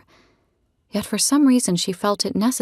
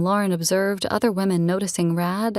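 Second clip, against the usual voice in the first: ordinary delivery, nothing marked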